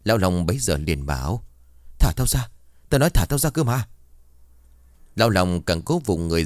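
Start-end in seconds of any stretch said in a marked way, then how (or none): 2.0-3.9 higher, urgent struggling cry; panicked demand. A narrator's reading. Man gripped tight by the creature, panicked demand. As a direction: demand release in rising panic.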